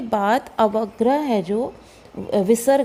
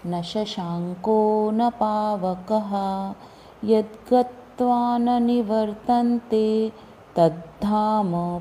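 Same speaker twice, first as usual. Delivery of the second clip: slow, measured chanting of the verse; slow shloka recitation; much slower than usual. Shown as a demonstration. Teacher chanting the Gita verse for students, slow and measured. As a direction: recite each syllable slowly and evenly.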